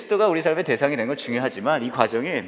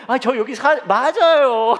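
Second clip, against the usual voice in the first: high, loud, hammed-up agreement; mock sudden recognition; 10 semitones higher, 7 dB louder, slower than usual. Pastor covering for forgetting where they live, overdone recognition. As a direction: cut in and cry out as if just remembering.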